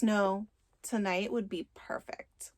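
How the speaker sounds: slow hushed whisper. Retold or imitated. Story cuts Cole off.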